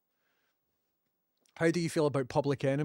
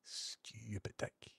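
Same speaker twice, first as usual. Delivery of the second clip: muttered insult; 3 semitones lower, 14 dB quieter, much slower than usual. Real, not acted. Annoyed at the bot's mispronunciation.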